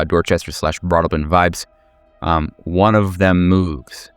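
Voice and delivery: ordinary talk, nothing marked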